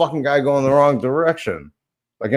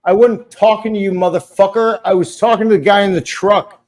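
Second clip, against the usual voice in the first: irritated retort; 6 semitones higher, 4 dB louder than usual. Retold or imitated. Retells a parking lot quarrel.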